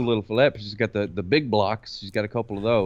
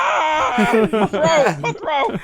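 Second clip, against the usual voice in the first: high, loud hammed-up yell; mock pained shout; 12 semitones higher, 4 dB louder, much slower than usual. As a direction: cry out as if suddenly bitten, comically. Acts out being bitten by baby.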